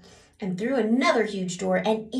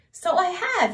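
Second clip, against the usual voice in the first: giggling; 11 semitones higher, 5 dB louder than usual. Acted. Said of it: "he giggled".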